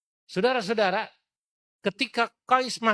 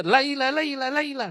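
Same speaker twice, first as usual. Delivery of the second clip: calling out; 8 semitones higher, 3 dB louder than usual. Acted. Qais chasing, calling.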